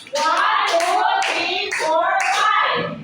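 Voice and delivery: lively, rhythmic count for applause; counting out claps. Real, not acted. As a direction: count out firmly on each clap. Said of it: "bigyan natin ng limang palakpak".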